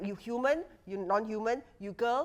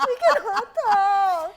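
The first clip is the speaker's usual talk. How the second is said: laughing; 9 semitones higher, 7 dB louder than usual. Real, not acted. Laughing protest on podcast.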